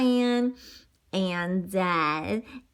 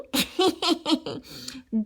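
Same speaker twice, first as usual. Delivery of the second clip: villain laugh; 7 semitones higher than usual. Acted. Wolf laughs with potion.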